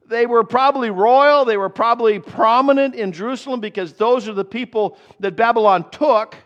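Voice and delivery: high, loud preaching, driving every question home; full-force preaching. Acted. Pastor quizzing the congregation about Daniel's parents, loud and high.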